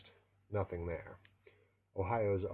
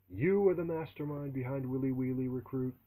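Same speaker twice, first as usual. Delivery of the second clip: bellowing; 5 semitones higher, 5 dB louder than usual. Acted. Drill sergeant bellowing at the recruit, inches from his face.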